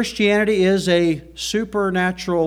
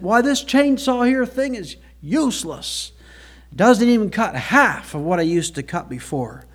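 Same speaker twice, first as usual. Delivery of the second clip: put-on country accent; 5 semitones higher than usual. [name] complains about the chainsaw.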